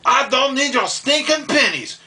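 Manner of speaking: high, loud angry mock scream; imitated scream. Waiter throwing pennies at Kyle, high imitated angry scream. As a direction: yell it like the furious waiter. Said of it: "screaming at Kyle".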